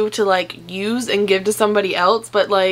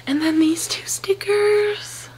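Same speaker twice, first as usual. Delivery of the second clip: whispering; 11 semitones higher, slower than usual. Real, not acted. Sudden hushed aside.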